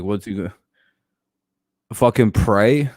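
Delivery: normal talking voice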